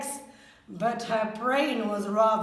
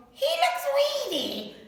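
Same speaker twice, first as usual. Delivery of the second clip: grumbling mum; 13 semitones higher than usual. A narrator's reading. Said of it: "She grumbled".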